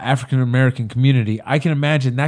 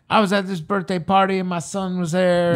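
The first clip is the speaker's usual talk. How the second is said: higher-pitched stand-up storytelling voice; put-on accented comic delivery, raised pitch; Kevin Hart impression; 4 semitones higher than usual. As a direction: imitate a comedian telling a bit, pitched up. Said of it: "I did that accent right there".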